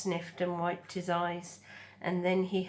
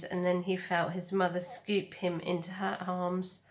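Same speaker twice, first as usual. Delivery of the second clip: ordinary delivery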